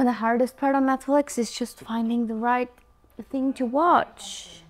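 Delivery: ordinary talk, nothing marked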